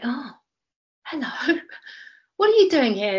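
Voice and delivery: high, startled hello at the door; high surprised oh hello, what are you doing here; surprised greeting. Retold or imitated. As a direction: caught off guard by the teacher, voice jumping up. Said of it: "I was like".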